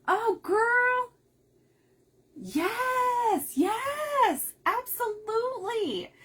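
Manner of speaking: high, loud yes yes; gushing enthusiastic yes; excited agreement. Real, not acted. Crafter answering a chat idea, excited high yes.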